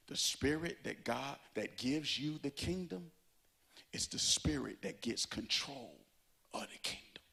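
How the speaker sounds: soft, drawn-in delivery, fading to a whisper; quiet first take. Real, not acted. Preacher slipping a point in softly before repeating it. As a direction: say it low, almost to yourself. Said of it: "let me rewind and repeat it".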